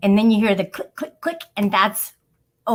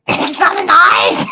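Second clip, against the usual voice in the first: laughing shout; 9 semitones higher, 8 dB louder than usual. Real, not acted. Shocked at eyelid speculum picture.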